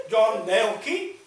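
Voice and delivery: slow, loud, drawn-out naming; imitating his odd phrasing. Quoting the fellow's funny name for John Key, drawn out. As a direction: stretch out each word of the name. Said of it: "That's what he said".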